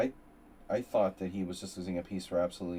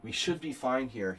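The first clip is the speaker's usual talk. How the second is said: usual speaking voice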